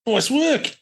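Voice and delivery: high, hammed-up Australian bloke; Aussie accent. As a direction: play a cheery outback mate. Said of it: "random noises coming out of my head".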